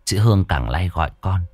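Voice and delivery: ordinary delivery, nothing marked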